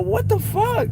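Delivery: high squeaky startled lady; mock her shocked high squeal; imitating the lady. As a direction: parody of a startled smoker lady. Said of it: "She was like".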